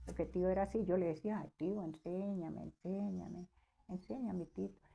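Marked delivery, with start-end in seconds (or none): 1.4-4.8 soft, repeated plea, gently wheedling; hushed coaxing of an uncle; retold pleading. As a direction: beg quietly, as if quoting yourself. Niece begging uncle to teach.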